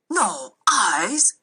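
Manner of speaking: a lightly booming disbelieving question; understated boom of the magistrate; mild boom. A narrator's reading. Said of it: "the magistrate boomed".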